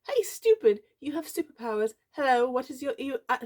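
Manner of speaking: high-pitched, exasperated character voice; high, hammed-up scolding; mock-scolding teacher. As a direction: play a squeaky teacher calling out an insult. Blooper of teacher's scolding translation.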